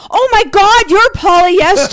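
high, loud burst of delighted surprise; retold amazed recognition. Star suddenly recognizing his tweeting fan, loud amazement. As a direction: exclaim it loudly, imitating an astonished star.

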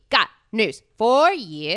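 slow teasing announcer build; dramatic announcement. Pretend. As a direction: drag it out like a reveal.

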